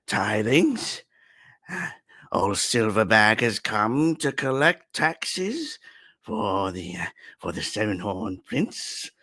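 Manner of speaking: a hint of a pompous goblin announcing himself, fading toward the end; goblin tax collector. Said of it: "the goblin speaks to the room and says".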